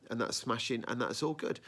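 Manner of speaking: normal talking voice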